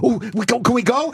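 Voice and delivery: put-on high, childlike pleading; eager kids. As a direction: cops begging like ten-year-olds, high and eager. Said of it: "like a bunch of ten-year-olds".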